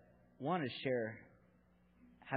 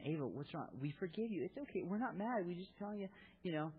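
gentle, higher comforting voice, lifted up high; reassuring; 6 semitones higher than usual. Retold or imitated. Dad comforting crying daughter.

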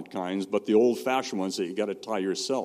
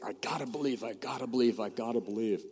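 softly strained, repeated self-urging; self-pressuring; 6 dB quieter, faster than usual. Retold or imitated. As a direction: mimic someone anxiously talking themselves into it, under the voice. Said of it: "we put the weight upon ourselves".

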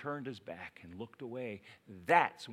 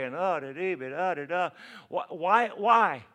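mock weary complaint; 6 semitones higher, 7 dB louder than usual. Mocking his own reluctance.

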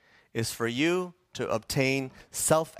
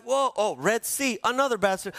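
higher mock alarm; alarmed whoa; 8 semitones higher than usual. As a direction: play the startled Israelites. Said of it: "it's like".

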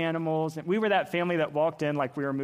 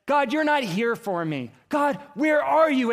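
high, loud, pleading protest; anguished cry to God; 9 semitones higher, 7 dB louder than usual. Retold or imitated. Father to God in his worst moment, crying out. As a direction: call out as if abandoned by God.

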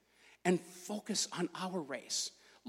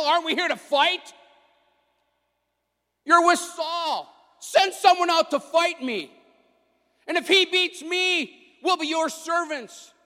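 giant's taunting yell; 6 semitones higher, 9 dB louder than usual. Retold or imitated. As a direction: bellow a dare across the valley. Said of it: "He yells, goes".

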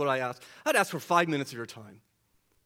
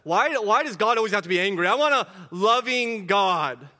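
a doubter's rising, louder complaint; voicing a doubter; 5 semitones higher, 8 dB louder than usual. Pastor voicing a skeptic in the pews, plaintive complaint. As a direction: plead like a frustrated skeptic, higher and louder. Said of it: "maybe you say that in your own heart".

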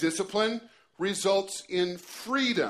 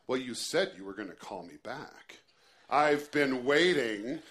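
sarcastic voice; 5 semitones lower than usual. Retold or imitated. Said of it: "in her best sarcastic voice".

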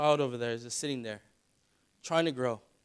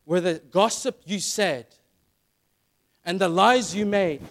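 louder, higher, fervent preacher's appeal; impassioned pulpit voice; preaching at full force; 4 semitones higher, 8 dB louder than usual. Acted. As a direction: press the call home to the congregation. Preaching warning to parents.

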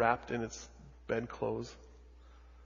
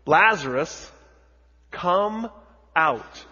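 higher, louder commanding call; Jesus calling Lazarus loudly into the tomb; 6 semitones higher, 11 dB louder, much slower than usual. Acted. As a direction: call him out of the tomb with full voice. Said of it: "Jesus says in a loud voice".

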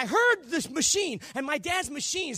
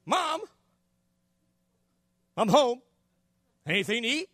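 put-on child calling out. Boy home from school to his mom, casual calling out. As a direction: kid casually calling into the house. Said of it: "You know how you do".

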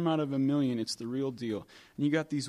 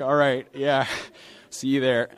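laughing, breezy reply, louder; amused brush-off; 4 dB louder than usual. Retold or imitated. Telling the congregation how he brushed off the stranger, laughing. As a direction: laugh it off, easy and friendly. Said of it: "I'm like".

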